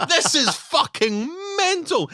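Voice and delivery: high, rising incredulous rant; mock disbelief. Viewer reeling at the little turkey, high and incredulous. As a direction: build up the disbelief to a near cry. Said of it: "and you're like".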